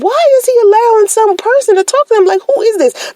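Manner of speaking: high, fast, suspicious; rapid-fire jealous questioning; indignant. Retold or imitated. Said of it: "And I'm like".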